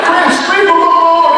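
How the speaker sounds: high, loud comic plea; mock pleading. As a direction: wail in protest on someone's behalf. Mock pleading with quiz writer.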